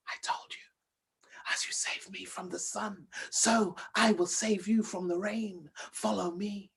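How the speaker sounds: soft, breathy, hissing snake voice begging for help; a tiny frightened snake calling out in a whisper. Acted. Small snake begging the princess to hide it, hushed whisper.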